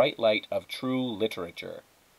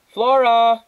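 much higher, loud call upstairs; mother shouting; 13 semitones higher, 12 dB louder than usual. A narrator's reading. Mother calling Flora upstairs, a loud shout. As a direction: yell her daughter's name up the stairs. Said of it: "her mother shouted".

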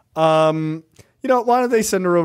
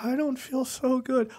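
mock whimper; 8 semitones higher, 8 dB quieter than usual. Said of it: "by day three".

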